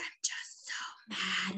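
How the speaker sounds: breathy whisper of pent-up anger; whispered fury. Retold or imitated. Daughter telling her mother she is furious, whispered. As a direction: hiss it under your breath.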